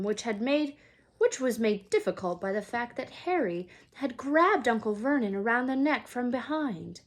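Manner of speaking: ordinary delivery, nothing marked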